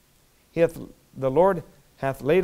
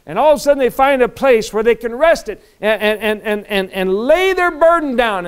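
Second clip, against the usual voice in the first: raised, loud, building preacher's delivery; loud, excited pulpit storytelling; preaching; 7 semitones higher, 10 dB louder than usual. Real, not acted. Preaches at full force.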